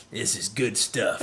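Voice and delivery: slow put-on voice, savoring it; imitated satisfied drinker. Host voicing Luke's look to Rey, slow imitated relish. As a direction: mimic a drinker relishing it, slowly. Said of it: "gives Rey that look like".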